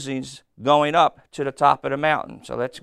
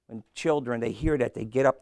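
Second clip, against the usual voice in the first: ordinary delivery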